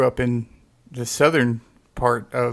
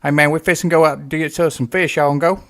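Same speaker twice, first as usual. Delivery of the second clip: put-on Southern accent; 3 semitones higher, 3 dB louder, faster than usual. Relatives inviting him fishing, in a Southern accent. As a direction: rattle it off like a Kentucky fisherman. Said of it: "people talking like this".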